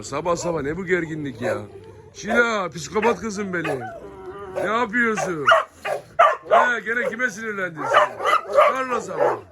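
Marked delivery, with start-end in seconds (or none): 2.1-8.1 high, doting voice for a dog; pet talk. Real, not acted. Cooing at his dog. 8.5-9.4 "Carlos'a mı" squeaked very high and teasing; pet talk. Real, not acted. Cooing question to the dog.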